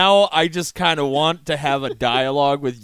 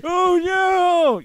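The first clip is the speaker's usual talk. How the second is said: much higher, loud panicked yelp; guard's high cry; 14 semitones higher, 4 dB louder than usual. Pretend. Guard getting beaten, acted.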